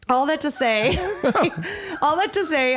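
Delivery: laughing while trying to finish the story. Real, not acted. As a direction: giggle while repeating it.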